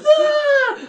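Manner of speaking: very high loud shriek; hammed-up scared scream; mock scream. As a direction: shriek like a startled guest. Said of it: "they would just be like".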